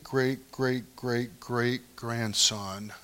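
slow, soft drawn-out great, great, great. Acted. Pastor stretching out David's lineage, slow and soft. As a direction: stretch each great out slowly. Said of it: "drawn out repetition".

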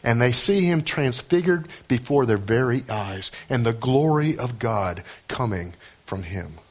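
normal talking voice

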